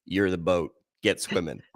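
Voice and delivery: imitating an unimpressed instructor, low and matter-of-fact; cool lifeguard instructor. Instructor answers a trainee looking for a boat, low and cool. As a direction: drop the pitch for a cool lifeguard's put-down. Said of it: "takes his, like, Baywatch sunglasses down and just goes".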